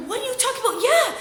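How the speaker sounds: high-pitched incredulous rant; exasperated. Retold or imitated. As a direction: protest in comic disbelief, pitch way up. Exasperated at friend's excuse.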